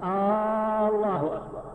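آه، الله أكبر with a long "aa" opening Allah, like a question; faulty takbir, lengthened initial hamza on الله; first hamza drawn out. Shown as a demonstration. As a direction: stretch the opening A of الله into "aaallahu akbar". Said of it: "إن مد همزة الله".

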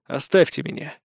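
subdued and brooding; suddenly gloomy. A narrator's reading. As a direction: send them away, darkly. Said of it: "тут же помрачнел".

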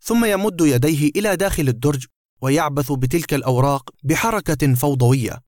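plain reading voice

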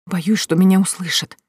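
usual speaking voice

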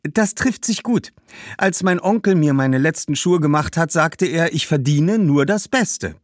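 put-on high, eager girl's voice; cheerful young girl. Girl telling the shoemaker she deserves the best, cheerfully. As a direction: play a pleased, confident girl.

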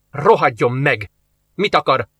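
ordinary delivery, nothing marked

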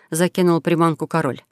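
ordinary delivery, nothing marked

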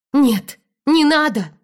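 high, loud cry of protest; urgent, high-pitched plea; exclaiming. Acted. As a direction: cry out to stop a blow. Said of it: "воскликнула я".

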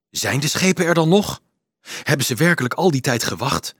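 ordinary delivery

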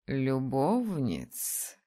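любовниц drawn out low and slow, falling; very slow, low dragoness echo; incredulous dragon. Acted. Dragon echoes the word.

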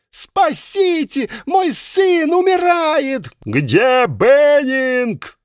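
high, slow screaming mother; hysterical mother. Acted. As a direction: plead for help in a shriek. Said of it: "мамаша Йоона".

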